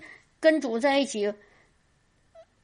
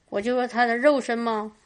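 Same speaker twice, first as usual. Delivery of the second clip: usual speaking voice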